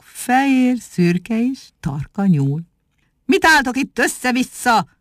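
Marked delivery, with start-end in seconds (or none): 3.2-4.9 high, stern bark; stern shout. A narrator's reading. Ugor orders the rabbits at the stable, sternly. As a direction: bark at the troops like a sergeant. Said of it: "kiáltott rájuk keményen".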